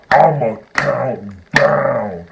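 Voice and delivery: low, very slow, loud warning; mock menacing threat. Tiny girl repeats countdown threat, deep mock menace. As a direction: imitate her with a deep, dragged-out threat.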